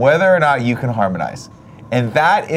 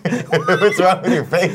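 laughing; 5 semitones higher, much slower than usual. Pretend. Teasing the pretend guest.

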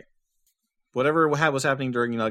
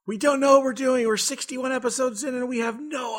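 high exasperated outburst; 12 semitones higher than usual. Real, not acted. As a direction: throw up your hands.

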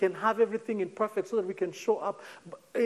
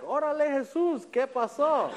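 higher, laughing disbelief in Spanish; Órale, Jesús said high with a laugh; incredulous Spanish exclamation; 8 semitones higher than usual. Pretend. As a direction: play the stunned friend laughing at Jesus. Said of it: "I'd go like".

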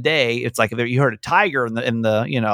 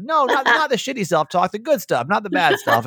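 high, hurried-sounding backtracking, easing down; mock correction; 8 semitones higher than usual. Radio host mimicking advisers on self-talk, mock insistent.

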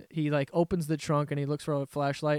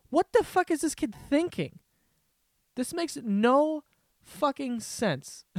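incredulous; 9 semitones higher than usual. Real, not acted. Ranting in disbelief.

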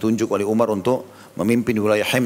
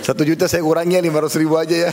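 a louder, higher jokey voice of a regretful donor; mock stingy giver; 5 semitones higher, 3 dB louder than usual. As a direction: play the haggling giver for laughs. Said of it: "kalau sudah sedekah jangan telepon lagi". (suara penyumbang menyesal yang dibuat-buat, bercanda)

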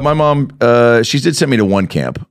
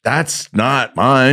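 slow denial; 4 semitones higher, much slower than usual. Retold or imitated. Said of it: "I was like".

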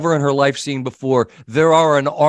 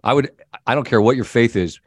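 normal talking voice

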